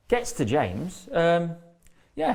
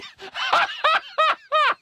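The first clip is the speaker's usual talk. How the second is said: big laugh. Real, not acted. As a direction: crack up at your own joke. Joke about the piss pot.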